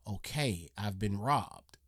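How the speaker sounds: low, soft and slow, the words sinking in; stunned quiet. Retold or imitated. Homeowner realising the house was robbed, hushed and stunned. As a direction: let the shock settle quietly.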